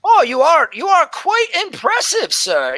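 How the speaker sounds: put-on high, awed old-lady voice; admiring old elf scribe. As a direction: marvel at the big warrior like a sweet old lady. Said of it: "Oh, oh".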